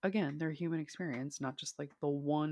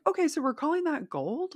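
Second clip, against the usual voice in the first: high-pitched, louder incredulous question; mock disbelief; 11 semitones higher, 5 dB louder than usual. Shopper questioning a mislabeled color, high mock disbelief. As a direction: act out baffled objection, pitched way up. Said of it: "and you're like".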